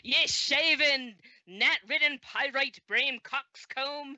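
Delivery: slow, high, loud archaic insult; mocking yell. Player casts vicious mockery on the burning foe, mocking yell. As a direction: hurl each insulting word at the enemy. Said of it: "vicious mockery on him and yell".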